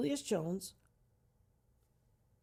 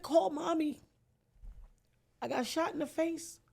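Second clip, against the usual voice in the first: distressed plea; 7 semitones higher than usual. Retold or imitated. Retold plea from hospital.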